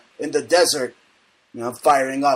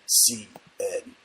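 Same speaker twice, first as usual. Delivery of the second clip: airline captain; 5 semitones lower, 6 dB quieter than usual. Acted. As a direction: speak like a captain over the intercom. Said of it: "this is your captain speaking".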